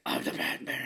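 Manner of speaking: whispered gravel Batman imitation; mock Batman rasp, whispered. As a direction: growl it like Batman, half-mocking. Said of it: "He's like".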